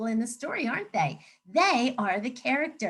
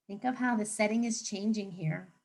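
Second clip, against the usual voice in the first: ordinary talk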